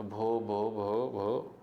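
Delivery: halting repeated syllable; mock stammer on the first syllable. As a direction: imitate a child stuck on her name. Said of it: "She could stammer no further".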